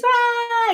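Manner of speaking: high, sustained mock scream; imitated jumping scream. Imitating her friend going off the jump, high mock scream.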